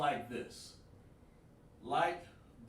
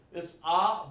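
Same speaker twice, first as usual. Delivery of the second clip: usual speaking voice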